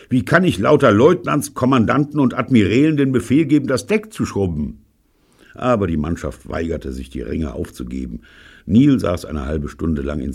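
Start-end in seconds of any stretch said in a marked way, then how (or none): 0.1-4.8 high, loud indignant outburst. Acted. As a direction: protest like an offended captain. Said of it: "Neil war entrüstet". (empört und laut)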